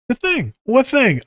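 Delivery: playful high back-and-forth; high mock exchange. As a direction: toss it back high and fast.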